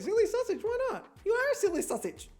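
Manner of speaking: high mock sing-song. Shown as a demonstration. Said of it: "Yeah, fuck it".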